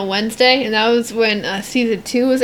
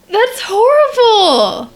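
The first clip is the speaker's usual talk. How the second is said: appalled; 10 semitones higher, 4 dB louder, much slower than usual. Real, not acted. Reacts to the cruel cancer prank.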